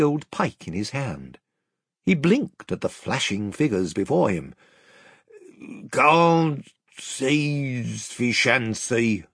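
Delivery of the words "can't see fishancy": slow, slurred, swallowed words; mumbling doorkeeper. A narrator's reading. Tousled gatekeeper blinking at the king's party, mumbling. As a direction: run the words together in a drawn-out mumble. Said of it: "he mumbled".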